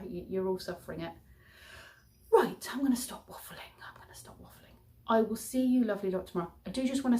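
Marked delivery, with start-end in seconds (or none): 2.3-3.7 hushed whisper, pitched up. Real, not acted. Wrapping up the vlog. 3.9-4.8 barely audible whisper, much softer; faint whisper. Real, not acted. Repeated, even fainter.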